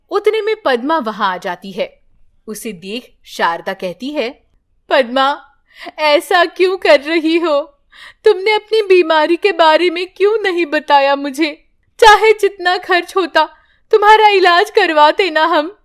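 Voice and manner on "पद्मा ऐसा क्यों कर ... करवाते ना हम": higher, louder anguished plea; hurt mother-in-law's reproach; pleading grandmother. Acted. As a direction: beg her to explain. Grandmother pleads with daughter-in-law.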